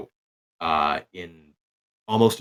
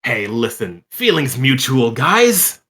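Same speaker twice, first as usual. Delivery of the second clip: Garrow's teasing reply; 5 semitones higher, 6 dB louder, slower than usual. Acted. Garrow answers the party.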